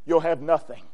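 normal talking voice

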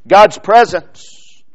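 ordinary talk

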